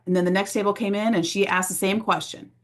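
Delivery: ordinary delivery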